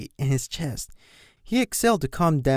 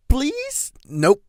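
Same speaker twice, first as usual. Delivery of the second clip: much higher, louder whining plea; high-pitched whine; 4 dB louder, much slower than usual. Acted. Colt begging his brother, high-pitched whine. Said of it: "whined in a high-pitched voice".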